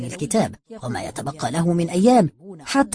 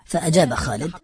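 usual speaking voice

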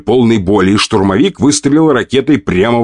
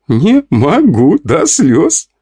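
laughing; 7 semitones higher, much slower than usual. Acted. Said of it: "Вика продолжала смеяться".

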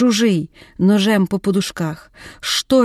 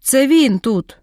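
raised-pitch narrated cry, held back; understated outcry, pitched up; mock scream; 4 semitones higher, slower than usual. A narrator's reading. Ada confronting her lover over the photo, restrained scream. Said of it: "Ада закричала, аж люстра під стелю дзенькнула".

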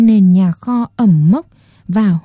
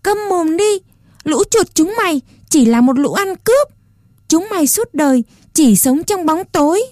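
much higher defiant cry; shouting; 8 semitones higher than usual. A narrator's reading. Puppet boy screams at the rats in the dark shed, defiant. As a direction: hurl it at the rats in anger. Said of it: "Chán Rô thét lên".

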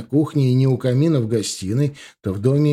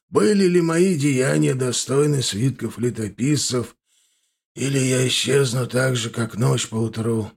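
put-on an old man calling to his queen; old man longing. A narrator's reading. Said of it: "прошептал дедушка языком ситхи". (тоскующий голос старика)

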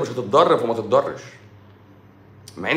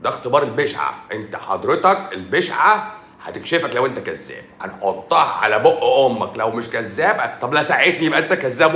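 usual speaking voice

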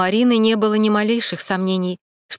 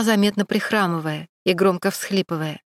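plain reading voice

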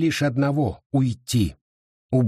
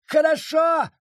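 shouted surrender. Acted. Wounded judge giving in to Abita, high panicked shout. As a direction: cry "хорошо!" out as the blade goes in. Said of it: "вскричал судья".

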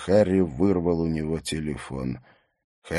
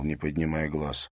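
ordinary delivery, nothing marked

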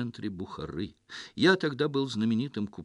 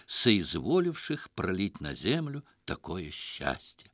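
ordinary delivery, nothing marked

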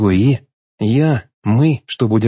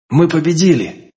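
higher, slowed cry of victory; triumphant; 7 semitones higher than usual. A narrator's reading. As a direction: announce the win with relief and pride. Hero announcing victory.

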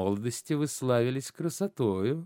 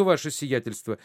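normal talking voice

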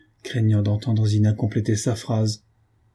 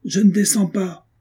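shouting; 10 semitones higher than usual. A narrator's reading. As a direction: cry it out sharply. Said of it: "Zina cria".

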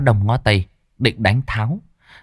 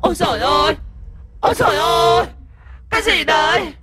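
much higher, wailing little ghost voice; shrieking ghost in pain; faster than usual. A narrator's reading. Ghost being whipped by demon guards, shrieking in pain. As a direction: cry out as the whips land. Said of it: "đau đớn rú lên".

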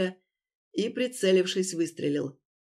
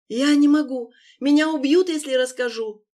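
terrified; 6 semitones higher, 7 dB louder than usual. Acted. Terrified refusal.

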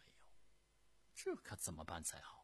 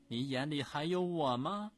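loud, raised imperious challenge; angry rebuke; 7 semitones higher, 11 dB louder than usual. Acted. Empress Dowager scolds the prince, loud and angry. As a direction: demand it with cold fury.

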